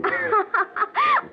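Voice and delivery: high bright giggle; coy high laugh; girlish laugh.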